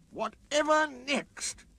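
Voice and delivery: slow, high scornful snort. A narrator's reading. Sir Handel snorting at the cattle truck coaches. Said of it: "he snorted".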